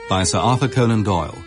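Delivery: normal talking voice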